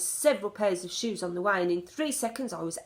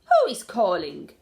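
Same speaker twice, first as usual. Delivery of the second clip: loud, curt snap; snapped; 10 dB louder than usual. A narrator's reading. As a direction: bite the words off sharply. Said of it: "snapped the Grand High Witch".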